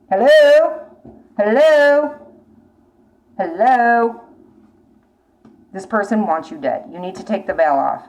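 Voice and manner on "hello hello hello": loud call, is anyone there; louder calling hello. Real, not acted. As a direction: call out to get their attention. Calling out to the viewer.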